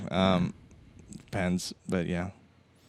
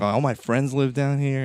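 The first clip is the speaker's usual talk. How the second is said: higher, louder cheerful retelling; great mood; 6 semitones higher, 6 dB louder than usual. Retold or imitated. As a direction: imitate a contented man, voice lifted. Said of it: "in a great mood".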